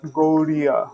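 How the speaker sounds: "Gaudiya" with an R-like middle; "Gaudiya", D softened toward R; flapped Gaudiya. Shown as a demonstration. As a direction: say "Gaudiya" slowly as if spelt with R. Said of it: "people actually write sometimes".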